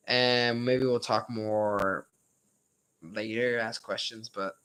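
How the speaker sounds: tired, strained voice. Real, not acted. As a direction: voice wearing out from talking. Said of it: "my voice is getting a little".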